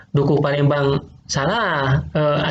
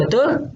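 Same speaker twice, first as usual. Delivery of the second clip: sharp high rising betul?; betul? asked with a steep upward lift; quiz question; 10 semitones higher than usual. Shown as a demonstration. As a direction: toss betul? up high to the class.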